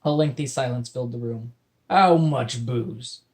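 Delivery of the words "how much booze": very slow pirate drawl; eager pirate. Acted. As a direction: savor the question like a thirsty pirate. Pirate asking about booze.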